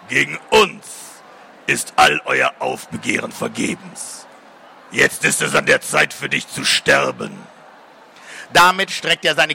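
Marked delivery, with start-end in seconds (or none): 0.1-7.4 softly murmuring demon. A narrator's reading. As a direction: murmur a death sentence like a demon. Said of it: "raunte er sanft".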